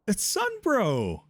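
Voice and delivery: high, slow, thrilled cry; excited squeal. Real, not acted. Gamer spotting Sunbro in the game, high thrilled squeal. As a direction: greet him like a long-lost friend.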